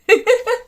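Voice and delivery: bright high burst of laughter. Real, not acted. A knitter laughing at her husband's surprise, high laugh. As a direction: laugh loud at the tiny needles.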